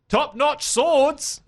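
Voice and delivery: high, long drawn-out blacksmith's cry; blacksmith game character. Acted. Blacksmith calling to passers-by in Novigrad, high drawn-out cry. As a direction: call it out like a hawker, stretching each word. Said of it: "there's a blacksmith at the Wit".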